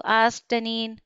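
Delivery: usual speaking voice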